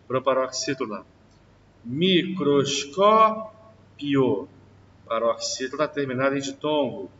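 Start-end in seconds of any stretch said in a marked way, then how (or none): 1.8-4.5 slow drawn-out word. Shown as a demonstration. Teacher slowly stretches the example word for the class. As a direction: stretch every syllable slowly. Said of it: "teacher drawing out example word".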